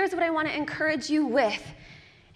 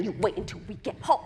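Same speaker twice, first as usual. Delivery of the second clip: mock parental threat; 4 semitones lower, faster than usual. Parent's threat after cheery act.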